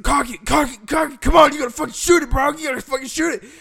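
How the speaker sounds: high, fast, frantic shouts; urgent retold shouting. Girlfriend's dad urging the boy to shoot, frantic shouting. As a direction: yell urgent orders, acting out the panic.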